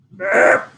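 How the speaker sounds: a little cartoon burp; a full, happy fly burping. Happy fly after lunch.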